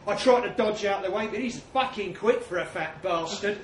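usual speaking voice

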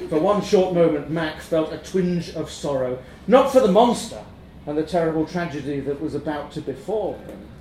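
ordinary delivery

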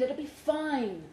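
ordinary delivery, nothing marked

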